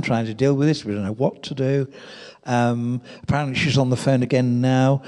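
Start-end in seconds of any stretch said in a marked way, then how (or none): none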